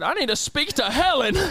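high-pitched irate demand; imitating a cranky customer insisting; mock-angry grandpa, pitch pushed up; angry old man. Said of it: "Why is he so angry?".

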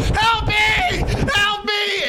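high, hammed-up yelling for rescue; mock cry for help. Boy fleeing to a stranger's house, mock screaming for help. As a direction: imitate a panicked kid calling out.

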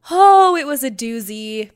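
loud, emphatic exclamation; exclaiming. Real, not acted. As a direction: belt it out with amazement. Exclaims about the wild night.

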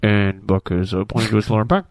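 low, hushed commentary voice; low running-commentary voice; mock visual describer. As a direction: describe it low, like an interpreter for listeners. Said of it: "we just need somebody going".

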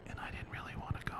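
whispered aside; hushed confession. Real, not acted. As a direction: drop to a near-silent whisper. Hushed confession during sermon story.

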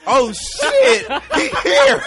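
high, loud, laughing excitement; excited shout. Retold or imitated. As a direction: yell it like a thrilled kid. Kid hearing Santa on roof.

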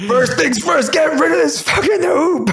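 high, loud, emphatic command; mock outraged order. Co-host ordering the basketball hoop gone, mock outraged. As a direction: bellow it through the laughs.

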